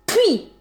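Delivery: sharp high spitting sound; a scornful "pah!"; contemptuous spit. Acted. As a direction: spit at him in disgust. Spitting at groveling ex.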